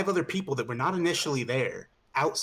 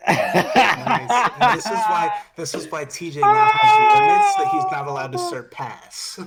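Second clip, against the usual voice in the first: laughing; 13 semitones higher, 10 dB louder, slower than usual. Real, not acted. Laughing wrap-up of story.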